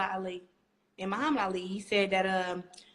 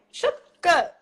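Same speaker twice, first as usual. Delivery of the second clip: high, loud angry retort; retold snap; 7 semitones higher, 10 dB louder than usual. Telling Rau'shee to back off, loud high retort. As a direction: bite back hard at the nagging. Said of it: "And I was like".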